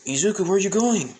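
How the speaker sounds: high, loud, quick call after him; alarmed shout. Acted. Father shouting after son running off, alarmed yell. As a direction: yell to stop the boy wandering off.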